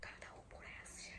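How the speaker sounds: frightened hushed whisper; scared whisper. Real, not acted. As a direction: whisper tensely, afraid of discovery. Hiding in fear, says scared.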